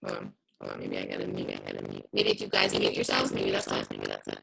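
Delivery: garbled robotic voice on a call; low, distorted robot voice. Real, not acted. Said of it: "your voice sounds like, like a robot".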